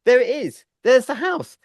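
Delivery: squealing high with excitement; excited. Retold or imitated. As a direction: gasp it out, thrilled to spot the house. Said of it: "And I was like".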